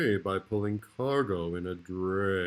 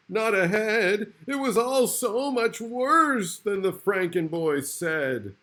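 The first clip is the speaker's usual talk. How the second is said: mock horror; 10 semitones higher, 5 dB louder than usual. A narrator's reading.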